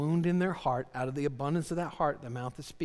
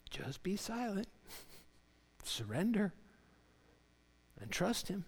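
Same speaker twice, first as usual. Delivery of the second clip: soft, higher-pitched, spaced-out plea; hushed appeal; 6 semitones higher, 6 dB quieter than usual. Retold or imitated. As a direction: let each phrase fall softly, with long pauses. God's rebuke softens into appeal.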